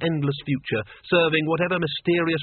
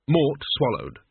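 ordinary delivery, nothing marked

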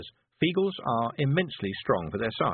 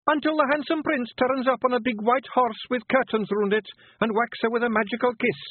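high, rough little Scottish pictie, gently; a tiny Scots warrior breaking bad news; Feegle voice; 14 semitones higher, 5 dB louder than usual. Billy's fairy tale.

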